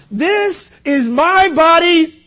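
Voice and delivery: high, loud, slow declaration; preached proclamation. Retold or imitated. Preacher quotes Jesus at communion, loud proclamation. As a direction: ring it out to the whole church.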